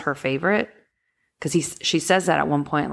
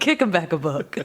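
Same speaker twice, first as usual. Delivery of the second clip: put-on country accent. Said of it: "with a real country accent".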